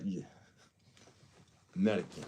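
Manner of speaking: loud, lightly mocked Russian accent; playful Russian accent. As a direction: punch it out in a put-on Russian accent. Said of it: "when a Russian person does it, says American".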